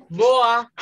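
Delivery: ordinary talk, nothing marked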